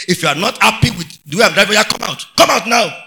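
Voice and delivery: loud confrontational challenge, building to a shout; angry driver. Acted. Angry driver telling a complaining passenger to get out, loudly. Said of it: "the driver got angry".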